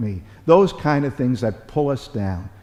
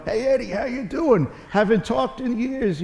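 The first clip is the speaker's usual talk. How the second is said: put-on Brooklyn accent; 12 semitones higher than usual. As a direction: do a Brooklyn accent for the old friend calling. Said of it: "He's got a real Brooklyn accent".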